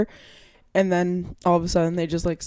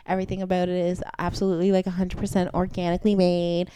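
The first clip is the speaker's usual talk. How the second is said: customer service voice. Retold or imitated. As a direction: gush like a phony sales rep. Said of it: "super fake customer service voice".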